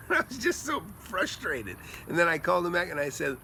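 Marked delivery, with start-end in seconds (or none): 0.1-1.8 higher, slow, laughing through the words; rueful laughing. Real, not acted. Investor recalling the refused offer, laughing ruefully.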